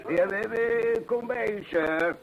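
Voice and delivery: high, loud call to someone away. Acted. Innkeeper calls off to someone across the house, loud. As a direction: call out that you're coming.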